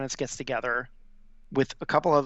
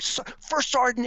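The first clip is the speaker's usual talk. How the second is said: higher-pitched imitated yell at the first sergeant; mock yell; 10 semitones higher than usual. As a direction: play the furious commander, half-hearted. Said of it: "He's, like, screaming".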